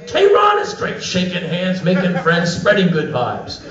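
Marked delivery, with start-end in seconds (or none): 0.1-1.0 very high enthusiastic reply; match their excitement, pitched way up; gushing back. Retold or imitated. American visitor returns a local's compliment, gushing and high.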